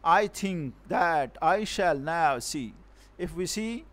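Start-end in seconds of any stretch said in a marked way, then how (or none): 0.0-2.7 verse line read slowly, word by word; slow, measured beat on "I think that I shall now see"; "I think that I shall now see" spaced out slowly in iambic feet; iambic scansion. Shown as a demonstration. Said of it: "one more example of iambic".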